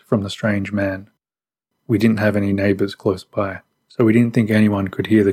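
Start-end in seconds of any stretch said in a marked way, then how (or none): none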